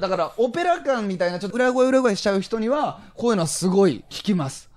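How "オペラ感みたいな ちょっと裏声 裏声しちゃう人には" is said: normal talking voice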